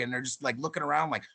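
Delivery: normal talking voice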